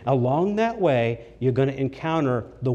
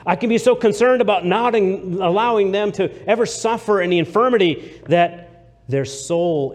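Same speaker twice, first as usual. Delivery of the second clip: rising preaching; 6 semitones higher, 5 dB louder than usual. Acted. Preacher rises mid-sermon.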